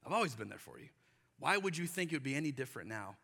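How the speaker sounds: soft, low, reassuring voice of God; God gently reassuring the fearful believer; gentle God. Retold or imitated. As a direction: quietly remind them you never left. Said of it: "and God's going".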